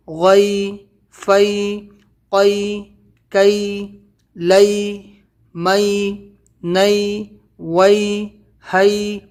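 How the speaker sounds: drawn-out single syllables on a level tone; reading without spelling. Shown as a demonstration. Teacher models reading without spelling out letters, evenly intoned syllables. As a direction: intone each syllable evenly, pausing after each. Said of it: "اور اس کو بغیر ہجے".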